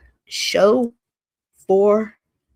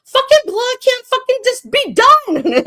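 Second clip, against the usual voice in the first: high, loud imitation of her exasperated rant; hammed-up furious squawk; mock pissed-off outburst; 6 dB louder than usual. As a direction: yell it high like a fed-up woman, mocking her. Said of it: "She's pissed because it's like".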